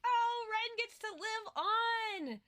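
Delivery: high, slow, starry-eyed joy; gushing delight. Retold or imitated. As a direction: swoon over the gift. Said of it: "I'm like".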